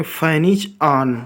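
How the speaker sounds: each word drawn out very slowly; teacher spacing out Fan is on; slow model sentence. Shown as a demonstration. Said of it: "हम उसे कैसे बोलेंगे".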